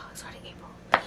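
a sigh, then whisper the words; whispered to herself after a sigh. Real, not acted. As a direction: breathe it out, deflated and hushed. Repeats it under a sigh.

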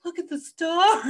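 high, loud wonder, imitating him; mock awe. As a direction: gush like an awestruck passenger. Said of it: "They're so beautiful".

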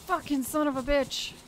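loud, frustrated swearing; angry curse. Real, not acted. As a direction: spit it out in anger. Angry curse after getting hit.

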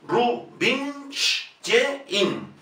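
Rubinstein very slow, soft, with ye and sh; Rubinstein, very slow, -shtyein; slow syllable demo. Shown as a demonstration. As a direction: stretch Rubinstein with ye. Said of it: "se pronuncia como ye".

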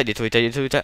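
ordinary talk, nothing marked

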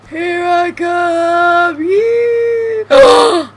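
long, high, loud holler building to a scream; whooping battle cry. Real, not acted. Player makes a daring jump, whooping holler. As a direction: holler it drawn out as you leap. Leaping across a gap in game.

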